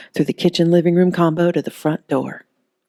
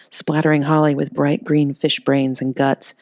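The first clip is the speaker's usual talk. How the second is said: plain reading voice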